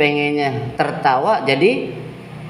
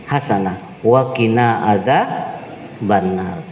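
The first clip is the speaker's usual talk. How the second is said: slow, measured Arabic recitation; Quran recitation; 4 semitones lower, slower than usual. Acted. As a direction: recite the verse slowly and reverently. (lantunan ayat Al-Qur'an yang pelan)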